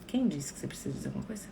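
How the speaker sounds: fast, soft whisper; hushed rhetorical question. Real, not acted. Answering a viewer's worry in a quick whisper. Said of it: "hushed rhetorical question".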